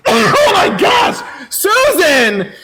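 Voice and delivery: high, loud, stretched shout of joy; ecstatic shout. Real, not acted. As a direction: scream the donor's name in delight. Shocked by big donation.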